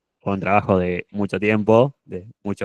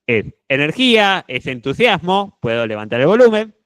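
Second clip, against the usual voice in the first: higher, bright with enthusiasm; energetic; 7 semitones higher, 4 dB louder than usual. Shown as a demonstration. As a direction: lift it with energy. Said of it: "quiero transmitir".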